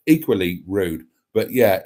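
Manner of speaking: ordinary talk, nothing marked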